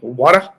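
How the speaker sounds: Water dropped low, loud, questioning; mock water. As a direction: mimic his Water in a low voice. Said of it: "You sounded like".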